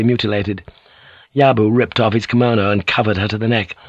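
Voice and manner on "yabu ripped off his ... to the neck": ordinary delivery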